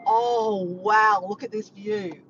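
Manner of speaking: loud retold wow at a view; big open exclamation of wonder; awestruck. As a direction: imitate someone amazed at the summit. Retold awe at summit view.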